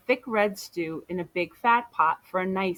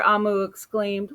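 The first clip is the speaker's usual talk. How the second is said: ordinary delivery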